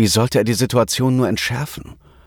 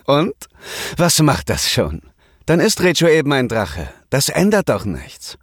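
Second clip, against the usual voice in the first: light, high dismissive voice; lightly voiced breezy girl; carefree young woman; 6 semitones higher than usual. A narrator's reading. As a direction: shrug it off brightly. Lucky shrugs off dragon news.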